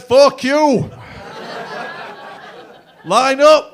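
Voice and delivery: loud, high barked order to the line; a headmaster shouting the class name at the school; headmaster yell. Retold or imitated. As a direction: bark it across the whole playground.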